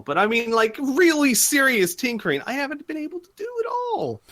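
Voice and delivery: high, loud and emphatic complaint; acted frustrated whine; exasperated excuse-maker. Voices a character making excuses.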